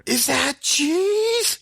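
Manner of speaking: very high, very slow, mock-horrified squeak; imitating wife. Husband mimicking wife finding cheese, high drawn-out mock dismay. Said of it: "You say it twice".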